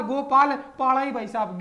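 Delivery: usual speaking voice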